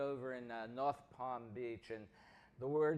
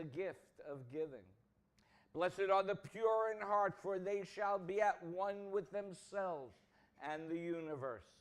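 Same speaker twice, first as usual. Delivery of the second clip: solemn scripture reading; 4 semitones higher than usual. Real, not acted. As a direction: read each blessing gravely and unhurried, letting line ends fall away. Minister reads rewritten Beatitudes aloud.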